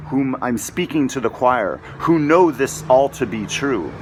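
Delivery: ordinary delivery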